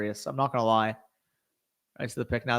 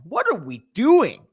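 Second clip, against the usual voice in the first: exasperated; 8 semitones higher, 6 dB louder, slower than usual. Real, not acted. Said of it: "I had to cuss that out".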